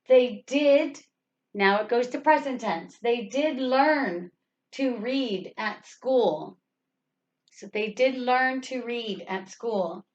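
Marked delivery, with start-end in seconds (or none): 3.0-6.6 slow careful reading with weight on "did"; stress on did. Shown as a demonstration. Teacher reading the corrected sentence, stressing "did". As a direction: drag it out and lean on "did". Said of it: "We added the did".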